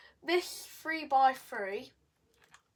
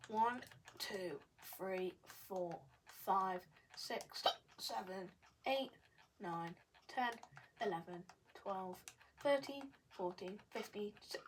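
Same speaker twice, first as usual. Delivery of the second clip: counting under breath; 5 semitones lower, 11 dB quieter than usual. Real, not acted.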